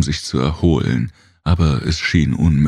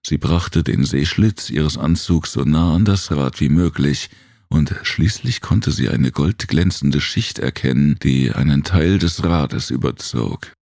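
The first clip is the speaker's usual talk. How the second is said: plain reading voice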